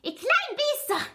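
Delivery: very high, squeaky shriek; shrieked insult. A narrator's reading. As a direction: shriek it as the furious zookeeper. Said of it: "schrie sie". (wütendes, sehr hohes Kreischen)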